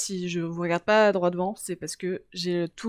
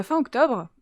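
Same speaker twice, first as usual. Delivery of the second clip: ordinary talk, nothing marked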